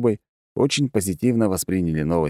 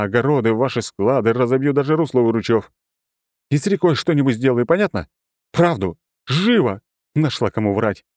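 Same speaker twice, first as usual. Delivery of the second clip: louder, menacing angry threat; grim and threatening; 6 semitones higher, 3 dB louder than usual. A narrator's reading. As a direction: threaten her own lying mother. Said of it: "девушка мрачно произнесла".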